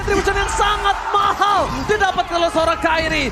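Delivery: loud, high hype play-by-play; fighting game announcer. Acted. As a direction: call the teamfight at full hype. Hype teamfight call.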